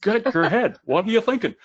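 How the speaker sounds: mock scolding coach accent, climbing high at the end; lightly put-on accent, rising into exasperation; Mike Ferry. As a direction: impersonate a blunt sales trainer. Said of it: "here's my Mike Ferry accent".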